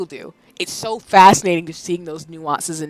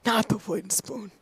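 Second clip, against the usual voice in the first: soft, subdued mock horror; hushed mock dread; 2 semitones higher, 10 dB quieter than usual. Repeating the wooden spoon to the chat, softly in mock dread. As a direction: drop it to a small, quiet lament.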